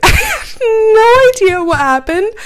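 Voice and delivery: high, loud, drawn-out squeal; laughing near-tearful wail. Real, not acted. Admitting she almost cried over it, laughing wail.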